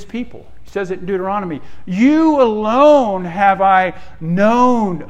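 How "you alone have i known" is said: loud, slow, raised proclamation; declaimed scripture. Acted. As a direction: proclaim it as God addressing his people. Declaims God's words to Israel.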